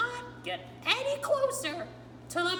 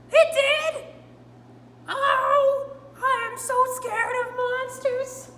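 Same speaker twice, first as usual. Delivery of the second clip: much louder, high and wailing; panicked outburst; 5 semitones higher, 11 dB louder, slower than usual. Acted. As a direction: Grover in a hammed-up fright. Grover panics about the monster.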